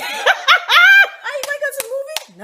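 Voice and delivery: high-pitched put-on voice; mock date invitation. Acting out a man's date invitation.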